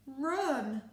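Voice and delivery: ordinary delivery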